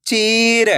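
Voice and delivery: चीर with the ee stretched long; चीर, held long for learners; drawn-out long vowel. Shown as a demonstration. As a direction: pull out the ee in चीर. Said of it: "तो आपको खींच के पढ़ना है".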